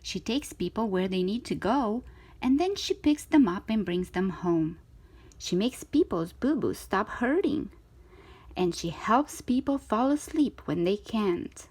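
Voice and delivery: ordinary delivery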